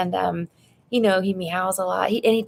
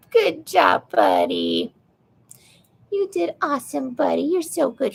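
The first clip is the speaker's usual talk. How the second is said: talking to a pet; 5 semitones higher, 3 dB louder than usual. Real, not acted. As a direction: praise the cat in a high voice. Praising cat Simon.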